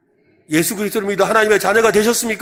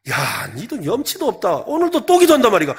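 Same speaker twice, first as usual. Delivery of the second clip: disgusted friend; 2 semitones higher than usual. Acted. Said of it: "이게 뻔뻔한 기도예요". (염치없다고 꾸짖는 친구, 점점 높고 크게)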